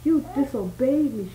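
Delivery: understated angry screech; mild screech. A narrator's reading. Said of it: "she screeched".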